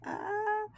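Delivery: short, soft high-pitched laugh; small laugh. Real, not acted. Teacher stumbling over a Spanish word, small soft laugh. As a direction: laugh lightly at your own slip.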